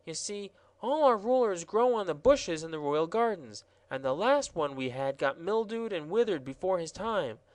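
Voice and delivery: high, prim gardener explaining; self-important gardener rules; gardener's high voice, earnest; fussy royal gardener. Acted. Gardener voice held.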